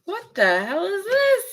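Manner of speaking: high, sharp disbelief; a real jolt of surprise; shocked. Real, not acted. Shocked by a tarot card.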